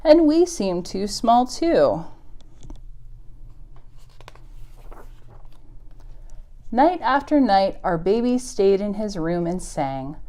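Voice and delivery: gentle picture-book read-aloud; story reading. Acted. Teacher reading a moose story to kids, storybook voice. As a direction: read it to the little ones, unhurried.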